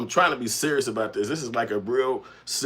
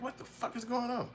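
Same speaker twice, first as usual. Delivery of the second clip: confused; 14 semitones higher, 11 dB quieter than usual. Retold or imitated. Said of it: "I was like".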